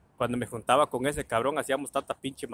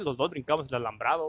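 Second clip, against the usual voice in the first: ordinary delivery, nothing marked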